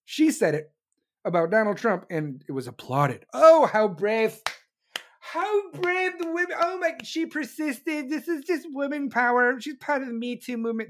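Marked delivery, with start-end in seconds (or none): 3.3-10.9 mock gushing. Admirers applauding a congresswoman, mock gushing. Mocking applauders of her remark.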